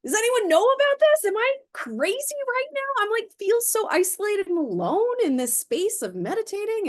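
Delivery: put-on a frightened child crying out, am I crazy; panicked kid. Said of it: "and I was like".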